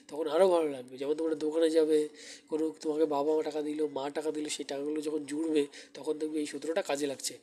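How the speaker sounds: ordinary talk, nothing marked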